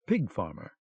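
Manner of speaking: low, soft disdainful mutter; muttered quietly. Acted. German officer scoffs at the papers to himself, quiet mutter. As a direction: mutter it under your breath with scorn. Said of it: "the German muttered quietly".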